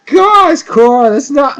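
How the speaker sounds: high, loud exclamation of disbelief; mock exasperation. Viewer reacting to Korra talking back, mock exasperated outburst. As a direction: burst out high, as if scandalized.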